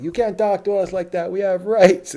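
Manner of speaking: loud, high-pitched indignant imitation; mock drunk protester. Drunk guest protesting to the bouncer, mock outrage. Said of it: "the guys were drunk by now".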